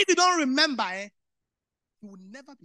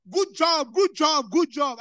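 loud, high shouts of good job; shouted praise; 6 semitones higher, 14 dB louder, slower than usual. Retold or imitated. Mimicking the boss yelling praise at workers, loud shout. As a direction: bellow it like a boss across the floor. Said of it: "Mister Gove will shout".

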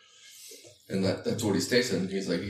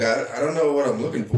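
louder, higher puzzled rookie voice; confused new guy; 5 semitones higher, 5 dB louder than usual. Retold or imitated. New guy at the truck, confused and lost. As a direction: play the lost, clueless new hire. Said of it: "they'll go out to the truck and be like".

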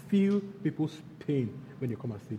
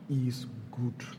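softer, lower and whispered is good; 5 semitones lower, 6 dB quieter than usual. Real, not acted. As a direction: breathe the words gently. Whispered tender truth.

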